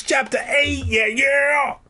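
high triumphant shout. Real, not acted. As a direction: shout the chapter out. Shouted chapter announcement.

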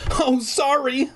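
very high apologetic cry. A narrator's reading. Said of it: "I cried".

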